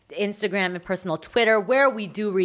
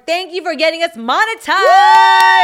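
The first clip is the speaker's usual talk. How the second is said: excited; 10 semitones higher, 11 dB louder, slower than usual. Real, not acted. Excited thanks to fans.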